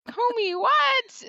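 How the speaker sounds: high squeaky "what?" of disbelief; shocked disbelief. Retold or imitated. Wife hearing husband's homeschool idea, shocked disbelief. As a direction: play it like you can't believe your ears. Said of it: "and I was like".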